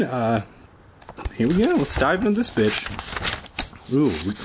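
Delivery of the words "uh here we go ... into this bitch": plain reading voice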